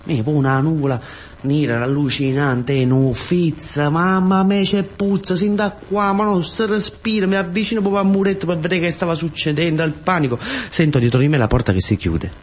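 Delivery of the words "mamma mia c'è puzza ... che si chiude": normal talking voice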